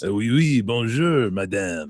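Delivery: hammed-up cartoon French; movie French accent. Imitating the man who speaks movie French, hammed-up accent. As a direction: ham it up like an old movie Frenchman. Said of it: "speaks like a French movie actor".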